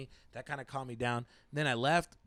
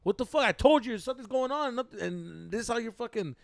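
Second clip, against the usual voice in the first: loud, high, rapid-fire confrontation; angry yelling; 7 semitones higher, 6 dB louder than usual. Retold or imitated. Boyfriend confronts the man on the phone, yelling. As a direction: act out chewing out a rival over the phone. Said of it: "I was, like, yelling at him".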